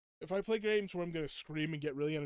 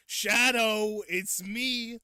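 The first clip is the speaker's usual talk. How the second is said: loud, high devil meme voice; hammed-up demon, punched and raised; comic devil; 6 semitones higher, 10 dB louder, slower than usual. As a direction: announce it grandly as a silly devil. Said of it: "the voice for it is so funny".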